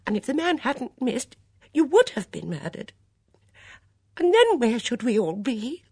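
high, worked-up distress; trembling, near tears. A narrator's reading. Dora frets over her friend's near murder, upset. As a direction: build to an anxious, high-pitched outburst. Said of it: "trembling as she spoke".

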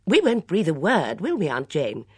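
lightly voiced chirpy younger woman; Bunch. A narrator's reading. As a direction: promise it heartily. Bunch promises secrecy.

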